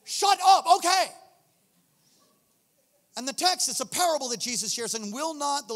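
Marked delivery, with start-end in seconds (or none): 0.0-1.1 loud, high exasperated give-in; exasperated judge. Retold or imitated. Judge giving in to the nagging widow, loud exasperated. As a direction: judge finally giving in, fed up. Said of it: "he's finally like".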